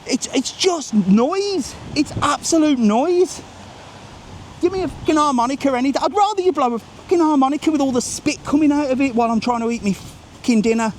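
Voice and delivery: high-pitched exasperated tirade; indignant, pitch pushed right up; worked-up rant. Real, not acted. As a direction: rant as if you've completely lost patience. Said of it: "Once I, once I go, I go".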